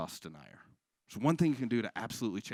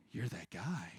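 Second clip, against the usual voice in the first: whisper; 4 semitones lower, 9 dB quieter, slower than usual. Acted. Acted confrontation at a bar.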